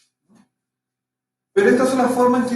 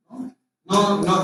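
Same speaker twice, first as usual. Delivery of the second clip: ordinary talk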